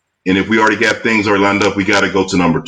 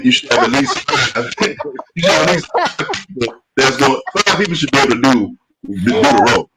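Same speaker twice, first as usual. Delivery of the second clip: higher-pitched, breaking up with laughter; laughing; 5 semitones higher than usual. Real, not acted. Producer joking about running out of casting choices, laughing. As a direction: try to get the words out through laughs. Cracking up over casting backup list.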